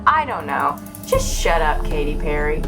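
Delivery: ordinary talk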